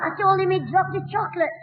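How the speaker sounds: ordinary delivery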